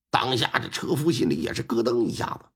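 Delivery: ordinary delivery, nothing marked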